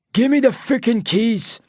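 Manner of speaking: high, snarling Scottish demand; angry Scottish accent. A narrator's reading. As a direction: lose patience in broad Scottish. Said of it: "he says in a Scottish accent".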